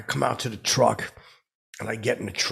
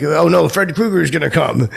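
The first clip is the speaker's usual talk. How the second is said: higher, louder mock alarm; playful fake panic at a horror villain; hammed-up fright; mock scared; 6 semitones higher, 8 dB louder than usual. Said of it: "Fred Krueger reference".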